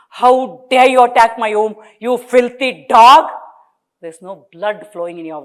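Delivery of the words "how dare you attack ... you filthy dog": loud furious cursing; wife cursing husband in rage. A narrator's reading. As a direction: spit the curse at him. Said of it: "started cursing him".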